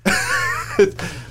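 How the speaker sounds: airy, high laugh at himself; high breathy laugh. Real, not acted. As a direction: burst out laughing. Laughs at own umpire call.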